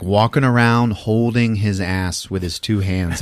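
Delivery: normal talking voice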